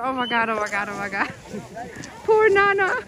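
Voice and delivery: ordinary talk, nothing marked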